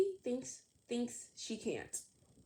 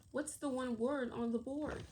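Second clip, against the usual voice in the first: ordinary delivery, nothing marked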